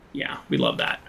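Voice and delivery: dry sarcastic whisper. Real, not acted. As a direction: lay the sarcasm on thick. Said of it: "that's sarcastic".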